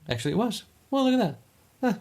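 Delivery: high squeaky falsetto; falsetto on stressed words. Pretend. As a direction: push the voice way up high. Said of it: "Makes my, makes my voice go high".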